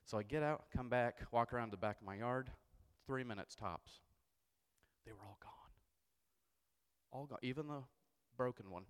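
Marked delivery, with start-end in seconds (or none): 5.0-5.7 hushed storyteller reveal; dramatic whisper. A narrator's reading. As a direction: drop to a whisper for the twist.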